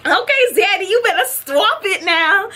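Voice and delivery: high-pitched, playful flirting; flirty hype. Retold or imitated. Flirting back at the celebrity.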